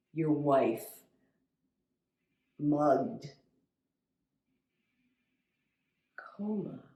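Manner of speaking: isolated words with long pauses; halting, stunned relay of bad news; fragments of a call. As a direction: let each word land alone. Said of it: "didn't catch most of what they said, just a few words".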